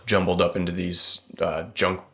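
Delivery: usual speaking voice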